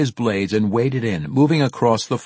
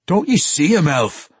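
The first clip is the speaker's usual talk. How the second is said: high, loud dwarf demanding an answer; agitated dwarf; 8 semitones higher, 5 dB louder than usual. A narrator's reading. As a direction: ask urgently as a rattled dwarf. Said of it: "Bruner asked".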